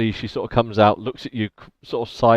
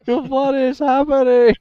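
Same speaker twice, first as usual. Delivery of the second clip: much higher, slow drawn-out cry of confusion; bewildered guard; 14 semitones higher, 5 dB louder than usual. Acted. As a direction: stretch the baffled question out. GM mimics bewildered guard again.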